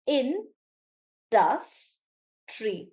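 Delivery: "in, dus, tree" with a clear pause after each; three separate beats, "in, dus, tree", the middle one firmer; syllable by syllable. Shown as a demonstration. Teacher breaking up industry for Anjali, syllable by syllable. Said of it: "when we try to break up the word".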